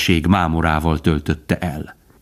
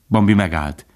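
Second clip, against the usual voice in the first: ordinary delivery, nothing marked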